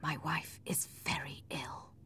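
very soft, slow and grave; hushed, near-whispered worry; quiet. A narrator's reading. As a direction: say it slowly under the breath. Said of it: "said Edgar quietly".

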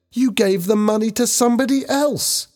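very high-pitched accusation; shouting. A narrator's reading. Helen realises Ben gave the money away, shouting. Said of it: "Helen shouted".

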